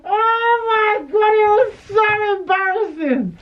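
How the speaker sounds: high, slow, loud wail of embarrassment; loud mortified shriek; embarrassed wail. Real, not acted. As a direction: wail it like you want to disappear. Reliving embarrassment, shouting.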